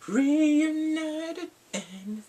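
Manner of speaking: high, slowed, swooning delight; gushing delight. Real, not acted. Fan swooning to viewers over the reunited characters, high and slow.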